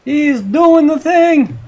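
high, loud, drawn-out yell; stretched-out hollering excitement; excited yell. Real, not acted. Yells as it happens in game.

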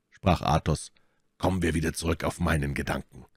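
usual speaking voice

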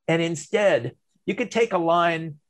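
ordinary talk, nothing marked

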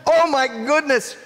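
high, loud amazement; amazed. Real, not acted. Teacher to a child who guessed the word, amazed. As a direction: react with big delighted astonishment.

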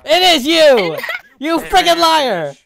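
loud, high accusing yell; angry accusation. Real, not acted. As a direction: call out the liar. Accusing the murderer.

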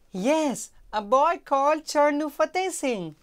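high, loud eager maid. Acted. Maid naming the witness to the queen, high and loud. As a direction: offer the name proudly.

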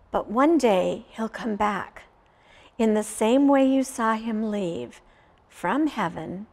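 ordinary delivery

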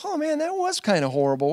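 high-pitched retold realization; dawning realization. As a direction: act out it suddenly sinking in. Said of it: "I'm like".

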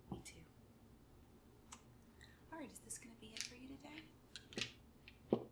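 ordinary delivery

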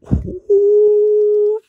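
long, very high, loud "ooh"; excited whoop. Real, not acted. Card opener spotting a fire pull, high whooping. Reacts to another good card.

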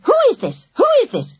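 exasperated woman repeating herself; exasperated demand. A narrator's reading. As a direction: demand it twice, fed up. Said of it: "sounding more and more exasperated".

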